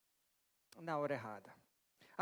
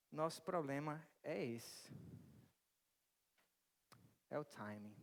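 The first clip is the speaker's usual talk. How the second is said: solemn; 5 semitones lower, 9 dB quieter, slower than usual. Acted. Sermon climax, slowing down.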